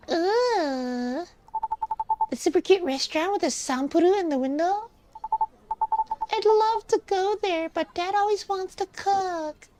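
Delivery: put-on high-pitched, keen nine-year-old; high child voice full of wishing; kid voice. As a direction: gush like a little kid. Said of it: "I'm reading in this kid voice".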